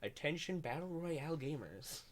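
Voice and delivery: mock formal announcement; announcer voice. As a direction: announce it like a broadcast alert. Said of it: "like, news reporter voice".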